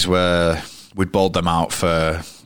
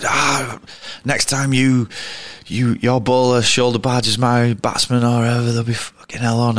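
aggressive Ponting; 6 semitones higher than usual. Retold or imitated. Ponting squaring up to Strauss.

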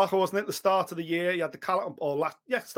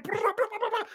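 rapid high "brr" punch noises; imitated punch flurry; 14 semitones higher than usual. Describing a fighter's comeback, high buzzing punch sounds. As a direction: rattle off punches like a machine gun.

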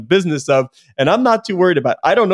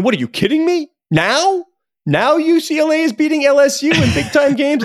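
higher, incredulous mock outburst; Larry Scott in disbelief; 9 semitones higher than usual. Podcaster imitating a stunned conference commissioner, incredulous higher voice. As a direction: play a stunned commissioner who can't believe it. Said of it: "Larry Scott must be sitting there going".

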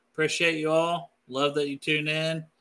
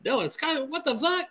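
incredulous reaction, pitched way up; mock astonishment; 9 semitones higher than usual. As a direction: imitate an astonished onlooker, voice climbing. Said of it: "be like".

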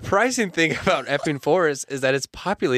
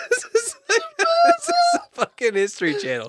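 laughing hysterically. Real, not acted.

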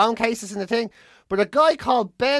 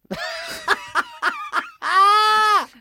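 big hearty laugh; 13 semitones higher than usual. Real, not acted. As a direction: burst out laughing at your own punchline. Punchline of the selfie story.

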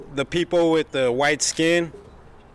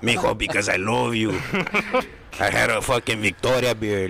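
exaggerated cartoon Mexican dad; hammed-up Mexican accent, drawn out; mock Mexican accent; 3 semitones lower than usual. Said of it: "Why are you talking like that?".

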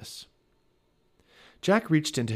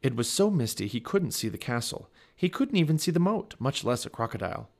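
plain reading voice

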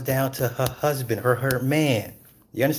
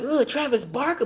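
mock disgust; 13 semitones higher than usual. Reacting to [name] dating [name], high disgusted. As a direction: sneer out the name in a high voice. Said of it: "So I'm like".

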